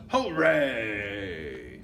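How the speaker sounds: drawn-out shout sliding downward; acted villains' cheer; witches' cheer. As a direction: let out a long triumphant hooray. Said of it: "all us witches shout".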